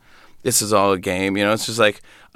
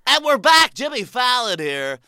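back-on-air DJ; 5 semitones higher than usual. Retold or imitated. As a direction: snap into on-air mockery. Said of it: "They go like".

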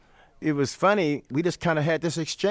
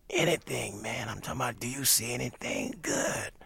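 soft, breathless greeting; imitating Miles's whispered rasp; raspy whisper; 9 dB quieter than usual. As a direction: rasp it barely above air.

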